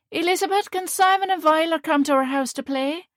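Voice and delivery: put-on high, eager Northern Ireland kid asking; child's Northern Ireland accent. Child asking Elizabeth if friends can play, Northern Ireland accent. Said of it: "their strong Northern Ireland accents".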